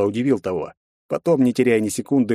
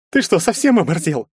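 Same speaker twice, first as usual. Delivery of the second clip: louder, higher angry challenge; jealous rival snarls; growling; 8 semitones higher, 4 dB louder than usual. A narrator's reading. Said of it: "зарычал Герцен". (рыча)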